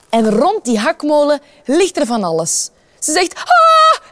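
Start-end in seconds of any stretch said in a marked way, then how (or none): 3.3-4.1 shrill, loud scream of fright; high scream. Acted. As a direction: scream out in sudden horror. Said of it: "en ze moet gillen". (hoge, luide gil van schrik)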